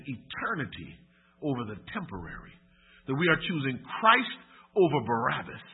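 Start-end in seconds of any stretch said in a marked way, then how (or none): 3.1-5.6 loud, stretched-out sermon emphasis; emphatic preaching. Real, not acted. Preacher urging church to choose liberty in God, loud and emphatic. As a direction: drive the appeal home forcefully. Preacher drives the appeal home.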